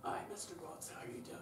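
a hint of gruffy damaged speech; gruffy child voice. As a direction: talk like a kid who yells a lot. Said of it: "they talk gruffy".

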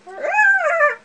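sudden loud high shriek; startled shriek. Real, not acted. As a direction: shriek at the bright light. Reacts to bright screen.

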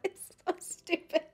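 real fit of giggles breaking through; giggling. Real, not acted. To her viewers, giggling at her own reflection. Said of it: "I can't stop laughing".

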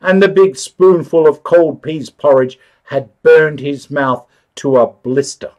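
usual speaking voice

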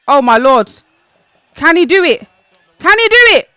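building live-commentary thrill; rising excitement. Real, not acted. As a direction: hold your breath then shout. Watching a chance build.

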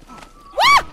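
high, loud disbelieving yelp; sudden alarm at what just happened; shocked what. Real, not acted. Startled by the horse vanishing, shocked and loud.

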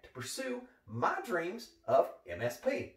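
ordinary talk, nothing marked

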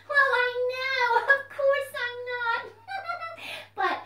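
high, loud, excited puppet voice; a bouncy little puppet popping up; puppet fox voice. Acted. Puppet fox greets the children, high and loud.

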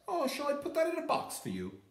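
high, sweet shop voice; sales lady. A narrator's reading. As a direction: offer it politely. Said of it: "the sales lady asked".

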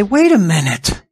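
offended insider claiming God as his own; mock jealous objection. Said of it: "some of them are gonna say".

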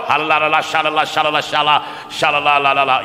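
rapid babbled la-la syllables; mock speaking in tongues. Preacher mimicking a man skipping around, mock tongues. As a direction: rattle off fake tongues in an offhand voice. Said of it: "going off in some tongue".

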